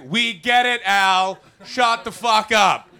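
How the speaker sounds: loud, slow fed-up snap; mock exasperation. Viewer yelling at boring documentary, loud and fed up. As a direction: yell it at the screen. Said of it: "you're just like".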